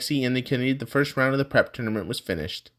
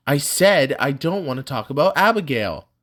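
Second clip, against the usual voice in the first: raised, insistent voice; a firm raised refusal; 5 semitones higher, 6 dB louder than usual. Acted. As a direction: push it louder, insisting. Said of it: "his voice raised".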